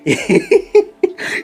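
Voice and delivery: sudden high-pitched laugh; high burst of laughter. Real, not acted. Laughing at Mourinho's dig.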